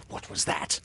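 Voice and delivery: plain reading voice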